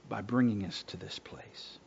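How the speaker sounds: tender whispered line; hushed reassurance from the pulpit. Real, not acted. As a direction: lean in and whisper it. Softly comforting the congregation.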